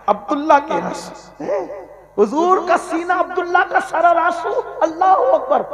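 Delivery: high, loud, laughing rapture; rapturous exclamation. Real, not acted. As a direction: burst out in joyous wonder. Ecstatic over Abdullah's fortune.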